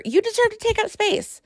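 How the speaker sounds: rising high with conviction; impassioned. Real, not acted. Host encouraging listeners, high impassioned cry. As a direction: lift it up like a rallying cry.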